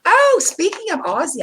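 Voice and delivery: ordinary delivery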